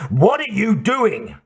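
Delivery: high-pitched angry challenge; demanding. A narrator's reading. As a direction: challenge them, pitch jumping up. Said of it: "the Mercedes driver demanded".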